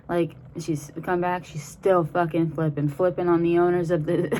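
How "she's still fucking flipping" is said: ordinary delivery, nothing marked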